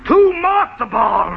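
slow, loud shout; cheated man shouting in shock; outraged surprise. Acted. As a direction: bellow it in disbelief. Finds the pocket's contents.

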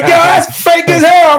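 very high, drawn-out jeer; imitating incredulous onlookers; mocking gym members. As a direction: heckle him in a squeaky mock voice. Mimics gym members mocking his phone voice.

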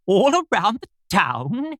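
slow, high, quivering question; nervous quiver. A narrator's reading. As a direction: let nerves shake the voice. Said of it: "his voice quite quivered".

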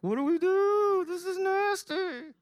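high, slow, squeaky panicked wail; hammed-up dad panicking over a diaper blowout; mock panic. A dad imitating himself calling his wife in a high mock panic. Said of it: "trying not to call my wife in a panic".

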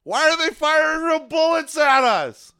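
high, loud panicked yelp. Acted. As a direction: shriek it in sudden panic. Acted panicked fan under fire.

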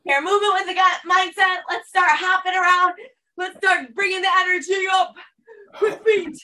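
high, energetic call to get moving; pumped-up coaching. Real, not acted. Coach urging the class to start hopping, pumped up.

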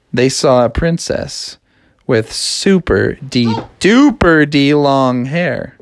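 ordinary delivery, nothing marked

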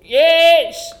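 normal talking voice

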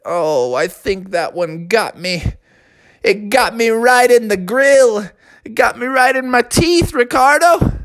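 put-on high, dazed whimpering kid; hurt batter telling the pitcher about his teeth. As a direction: tell him where it hit. Hurt Bubby describes injury.

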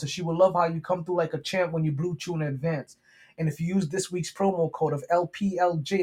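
usual speaking voice

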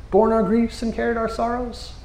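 high, hushed in wonder; awed. Real, not acted. Preacher marveling at Isaiah's prophecy, high and awed. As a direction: let the wonder lift the voice.

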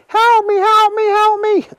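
very high, loud panicked cries; pinned down and calling for help; yelling for help. Acted. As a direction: Willie trapped under the worm, shrieking. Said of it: "yelled Willie".

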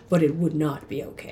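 lightly voiced deep narrator; low, soft mock movie narration; Morgan Freeman narrator. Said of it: "where Morgan Freeman steps in".

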